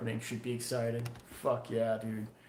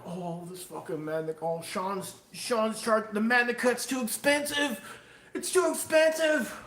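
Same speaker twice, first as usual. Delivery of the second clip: mock the complainers, climbing into a whine; mocking whiny buyer; 7 semitones higher than usual. Knife maker mocking buyers who whine about price, rising into a high whine. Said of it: "It's not gonna be like".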